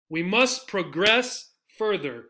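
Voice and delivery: slow, with pro-GRESS stressed at the end; second-syllable stress. Shown as a demonstration. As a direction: land on GRESS in progress. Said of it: "Second syllable".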